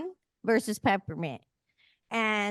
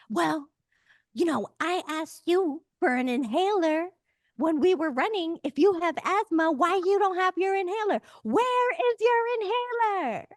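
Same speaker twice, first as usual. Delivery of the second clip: a hammed-up grilling voice, higher and pressing; mock-interrogate him, climbing higher to the last question; accusing interrogator; 8 semitones higher than usual. Tamara grilling John, quoted.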